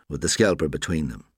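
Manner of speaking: ordinary delivery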